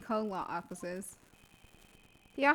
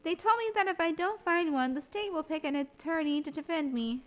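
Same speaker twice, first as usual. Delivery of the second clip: put-on higher, brighter girl voice; young girl voice; 6 semitones higher than usual. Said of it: "oh, wait".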